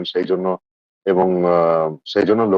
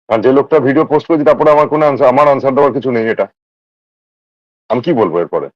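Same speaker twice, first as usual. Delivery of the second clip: higher, louder exasperated voice; indignant protest; 5 semitones higher, 4 dB louder than usual. Real, not acted. Telling a friend there is nothing to answer, indignant. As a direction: push back, voice raised in protest.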